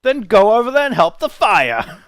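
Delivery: high, shouted command in a guard's voice; exasperated guard order. Acted. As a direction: yell at them to get going. Guard orders the helpers away.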